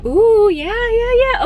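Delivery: high, loud delighted squeal; thrilled squeal at the shine; excited. Real, not acted. Finds the light on her highlight.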